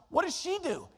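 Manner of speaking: usual speaking voice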